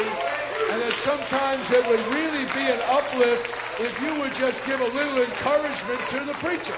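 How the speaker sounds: thundering Pentecostal sermon; full-force preaching. Acted. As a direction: drive it home from the pulpit.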